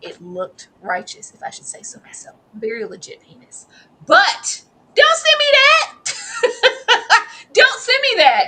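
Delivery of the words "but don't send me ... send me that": high, loud, slow, laughing through it; laughing protest. Real, not acted. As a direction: protest while cracking up.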